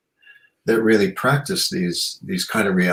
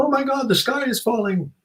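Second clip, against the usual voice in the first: mock panic. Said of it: "that's not a call to go".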